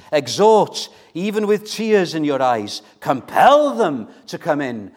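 forceful exhortation, pitch arching high on key words; fervent preaching. Acted. Preacher urging the church to compel them, fervent exhortation. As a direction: press each phrase home with urgency.

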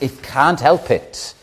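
ordinary delivery

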